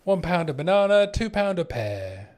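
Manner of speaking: hammed-up London sales chant; London accent. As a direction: hawk it like a London stallholder. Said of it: "that's what we need in this game. More London voice lines".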